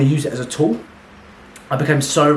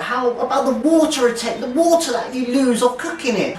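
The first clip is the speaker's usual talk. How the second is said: mocking high questioner; 12 semitones higher than usual. Mocking a fussy viewer who asks about water loss, in a high whine. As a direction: play the nitpicking viewer, pitched way up. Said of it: "you're like".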